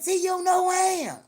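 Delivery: mock offended call. Girl at the register calls out to T, high and loud. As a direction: holler it like you can't believe it.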